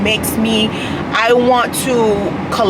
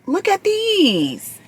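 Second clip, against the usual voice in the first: excited; 10 semitones higher, much slower than usual. Real, not acted. Gushing over gifted earbuds.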